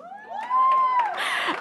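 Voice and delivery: a bright squeal of laughter, high up; delighted high laugh. Real, not acted. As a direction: laugh out with real joy. Laughing at son's good news.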